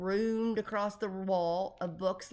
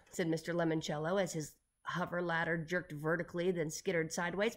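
ordinary delivery, nothing marked

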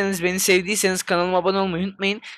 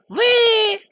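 a sudden louder, very high squeal; shrill squeal; 6 dB louder than usual. Real, not acted. Gamer yelping as his rider goes flying, high squeal. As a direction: yelp "vıy" high and loud.

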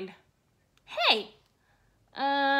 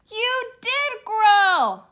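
amazed; 7 semitones higher, 5 dB louder, slower than usual. Acted. Friend amazed at the growth.

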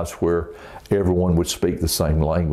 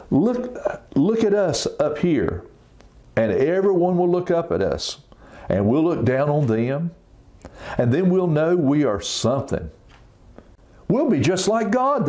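high, proud and boastful; kids' story builders bragging, voice way up; boastful crowd; 10 semitones higher than usual. Acted. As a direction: brag to the world, pitched up high.

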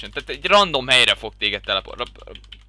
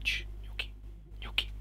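whispered calm-down hushes; shushing; 6 semitones lower, 12 dB quieter than usual. Real, not acted. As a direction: hush yourself down softly. Calming himself after chaos.

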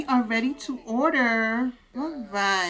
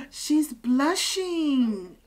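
delighted gasp; 6 semitones higher, much slower than usual. Real, not acted. Streamer gasping to viewers that she blushes. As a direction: gasp then stretch it out slowly, higher.

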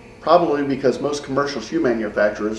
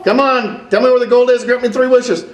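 higher, louder grabbing shout; excited yell; 10 semitones higher, 5 dB louder than usual. Acted. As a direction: shout in triumph. Host grabs leprechaun, yelling.

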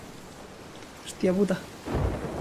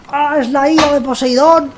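hammed-up epic cry, higher; mock dramatic exclamation; 6 semitones higher than usual. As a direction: proclaim it like a storm god's wrath. Storm hits, dramatic exclamation.

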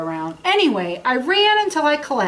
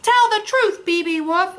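teasing call; 8 semitones higher than usual. Acted. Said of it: "winked someone in the back".

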